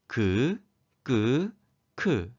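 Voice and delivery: 그, 끄, 크 as separate, evenly spaced syllables; consonant demonstration. Shown as a demonstration. Teacher models consonants grouped by strength, 그, 끄, 크 sounded out separately. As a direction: sound out 그, 끄, 크 one at a time: plain, tense, aspirated. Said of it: "자음들을 발음해 볼까요".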